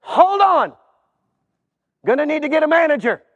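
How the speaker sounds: a clerk calling for backup; flustered gatekeeper. Acted. Gatekeeper gives up on the man.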